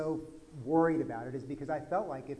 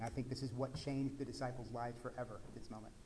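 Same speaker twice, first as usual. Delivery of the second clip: ordinary talk, nothing marked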